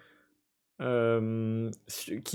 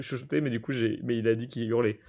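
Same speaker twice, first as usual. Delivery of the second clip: ordinary delivery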